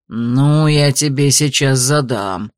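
low, slow menace; threatening. A narrator's reading. Carter advancing on the beggar, low threat. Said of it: "пригрозил он".

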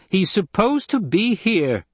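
slow, high-pitched, emphatic snap; snapped. A narrator's reading. Lady cutting off the guard's suggestion, snapping. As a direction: overrule them with biting emphasis. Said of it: "Jessica snapped".

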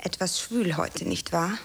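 low, dramatic line delivery; stage line. Acted. An actress rehearsing her big line, low and dramatic. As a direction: play the sultry heroine.

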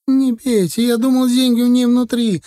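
high, pleading yell; frightened cry. A narrator's reading. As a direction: cry out in fear, shielding face. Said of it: "закричал Титок".

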